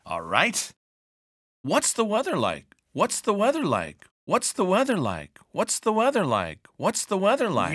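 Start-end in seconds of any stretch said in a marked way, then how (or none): 1.6-7.7 five times, one breath, no pauses; all in one breath. Shown as a demonstration. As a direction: rattle it off without breathing. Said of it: "Five times in one breath".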